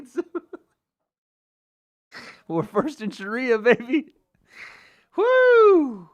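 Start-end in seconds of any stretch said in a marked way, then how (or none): 5.2-6.1 high, loud whoop of glee; cheer. Real, not acted. Streamer whooping after a funny chat comment. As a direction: let out a big whooping cheer.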